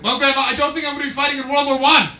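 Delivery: loud, high, fast retort; rapid-fire comic outburst at grandma; exasperated shouting. Acted. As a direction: yell back in mock exasperation.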